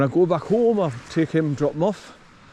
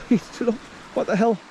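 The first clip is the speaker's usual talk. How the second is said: surprised; 7 semitones higher than usual. Real, not acted. Surprised at the dog.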